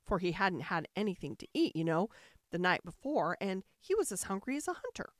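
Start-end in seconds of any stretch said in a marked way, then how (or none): none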